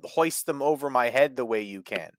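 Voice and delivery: high, pleading mock protest; mock aggrieved wrestler. Luchador begging Boulder to leave his moves alone, mock aggrieved. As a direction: plead like a hammed-up put-upon wrestler.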